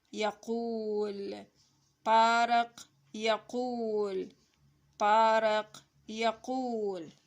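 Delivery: drawn-out words, loud first syllables; slow model reading. Shown as a demonstration. Teacher reading the lesson to children, slow syllabled reading. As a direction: read word by word, stretching long vowels.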